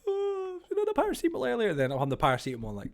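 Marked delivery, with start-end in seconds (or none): 0.1-1.7 very high, wailing moan, quickly spoken; hammed-up regretful wail; mock whinging. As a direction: whine it in comic agony. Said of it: "just sitting there whinging".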